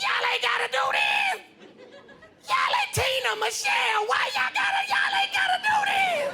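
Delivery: frantic peacemaker. Retold or imitated. Small woman between fighters pleads, frantic high shriek. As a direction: shriek at them to stop fighting. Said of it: "jumping up and down like".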